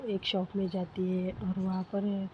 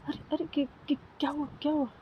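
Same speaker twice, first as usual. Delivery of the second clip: alarmed; 7 semitones higher, much faster than usual. A narrator's reading. Alarmed friend after sudden scream.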